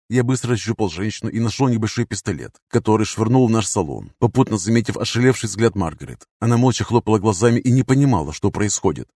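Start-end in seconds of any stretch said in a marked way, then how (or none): none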